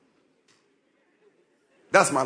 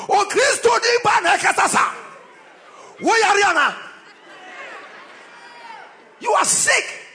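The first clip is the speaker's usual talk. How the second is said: fiery preaching; 11 semitones higher than usual. Acted. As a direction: thunder at the sinners. Preacher shouts a rebuke.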